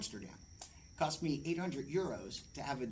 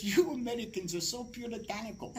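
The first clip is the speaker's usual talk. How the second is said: higher, louder put-on European doctor; teasing doctor with a put-on accent; mocking foreign doctor; 8 semitones higher, 5 dB louder than usual. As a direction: scoff at the prudish Americans. Doctor mocks the Americans.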